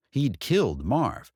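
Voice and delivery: ordinary delivery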